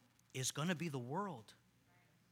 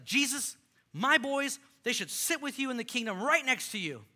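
higher and louder, an eager mother pleading; passionate mom; 7 semitones higher, 6 dB louder than usual. Retold or imitated. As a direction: plead for your boys like a pushy, passionate mom. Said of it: "moms who are passionate".

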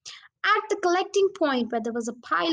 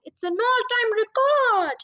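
higher, louder and slow, bursting with pride; a father crowing over a record haul; thrilled father; 9 semitones higher, 5 dB louder than usual. Acted.

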